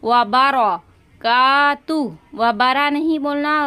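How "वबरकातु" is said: वबरकातु very slow, louder, thick र; heavy ra. Shown as a demonstration. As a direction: recite वबरकातु with a full heavy र. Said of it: "रा को मोटा पढ़ना है". (मोटा र, धीरे)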